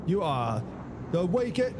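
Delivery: high mock Australian quiz-host line; hammed-up Australian accent. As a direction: jab it like a game-show host, Aussie style. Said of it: "Australian accent".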